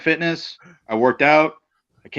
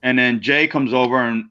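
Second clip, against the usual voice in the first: ordinary talk, nothing marked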